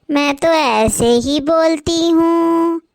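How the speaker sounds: slow, drawn-out, lilting offer; strange slow bride voice. Acted. Bride offers husband breakfast in a weird slow lilt. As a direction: croon it oddly slowly. Bride defends her odd speech.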